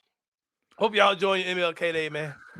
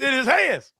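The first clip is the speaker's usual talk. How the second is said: mock cry; 8 semitones higher, slower than usual. Mock wailing over dog.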